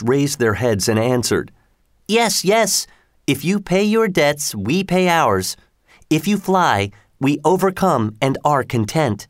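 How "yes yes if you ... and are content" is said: talking fish voice. A narrator's reading. Talking fish answer the slave.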